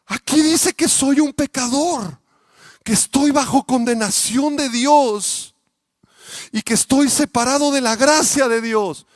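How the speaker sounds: high, loud, slow distressed pleading; desperate tearful outcry; anguished boy. Retold or imitated. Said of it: "le dijo el niño".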